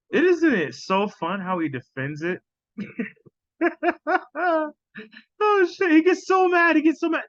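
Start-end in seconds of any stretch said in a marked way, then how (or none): 3.6-4.7 sudden high cackle of delight; high burst of laughter. Real, not acted. Laughing at the clip. 5.4-7.2 high, louder, laughing through the words; gleeful and cracking up; laughing excitedly. Real, not acted. Laughing at clip, highly amused.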